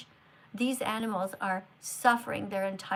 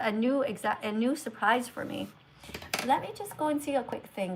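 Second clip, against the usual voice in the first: ordinary talk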